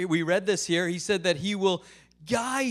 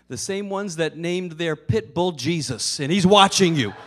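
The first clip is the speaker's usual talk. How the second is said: parrot's punchline; 9 dB louder than usual. Acted. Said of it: "And the parrot says".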